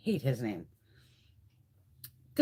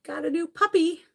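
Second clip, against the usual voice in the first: high, louder sing-song mock; mocking post; 12 semitones higher, 5 dB louder than usual. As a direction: parrot their post in a silly high voice. Mocking the friends' Facebook post.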